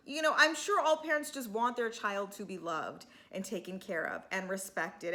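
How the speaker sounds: ordinary talk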